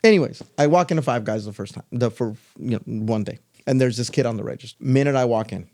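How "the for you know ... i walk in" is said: normal talking voice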